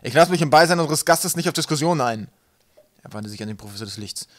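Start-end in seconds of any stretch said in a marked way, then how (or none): 0.0-2.3 loud, high, commanding outburst; bellowed. A narrator's reading. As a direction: thunder it out, refusing any argument. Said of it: "brüllte Wolkenwolf".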